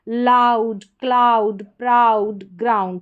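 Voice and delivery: लाउड, क्लाउड, प्राउड with a full "ow". Shown as a demonstration. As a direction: call out लाउड to ग्राउंड one by one, firmly. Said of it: "आउ करके इसका उच्चारण है".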